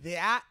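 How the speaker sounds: "That" with an exaggerated ee-ah A; "That" with the vowel broken in two; Cleveland vowel. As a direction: imitate her Cleveland "That", like thee-at. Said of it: "And she said, like".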